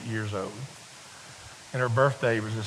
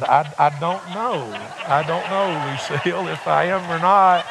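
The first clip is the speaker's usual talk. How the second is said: laughing; 3 semitones higher, 5 dB louder than usual. Real, not acted. Laughs at being asked.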